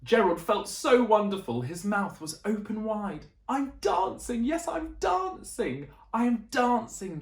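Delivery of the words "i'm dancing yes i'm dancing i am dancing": higher, delighted and amazed; joyful cry. Acted. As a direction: cry out with pure joy. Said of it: "Gerald cried".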